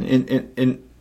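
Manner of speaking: normal talking voice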